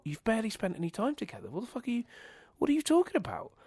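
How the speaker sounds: what are you even talking about, pitch climbing; incredulous. Retold or imitated. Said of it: "mocking incredulous reaction".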